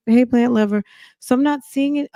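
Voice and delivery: normal talking voice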